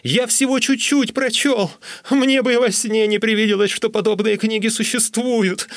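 high-pitched, desperate pleading; high, insistent wail; frantic squealing. A narrator's reading. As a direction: squeal your protest, pitched right up. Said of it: "визжал я".

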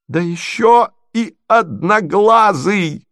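high, drawn-out jeering cry; crying out insult. A narrator's reading. Marya joins in insulting prince, crying out high. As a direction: swoop up and call out the insult. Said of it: "закричала Марья Александровна".

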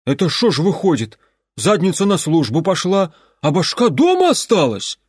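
high, loud exclamation full of envy; envious exclaim. Acted. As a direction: cry out with open envy. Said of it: "с откровенной завистью воскликнул".

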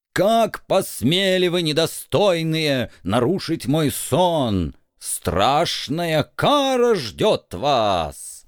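slow, menacing ghostly vampire. Acted. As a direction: drag each threat out slowly. Ghost vampire threatens guests.

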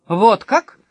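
loud, cool retort; cold. Acted. Sofia unmoved by her rescuer Bastian, cold reply. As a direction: answer with cool disinterest. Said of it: "холодно сказала София".

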